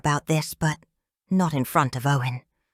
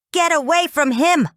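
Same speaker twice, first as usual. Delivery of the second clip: high, loud protective shout; yell; 12 semitones higher, 6 dB louder than usual. A narrator's reading. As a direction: scream at him to back off. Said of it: "Owen yells".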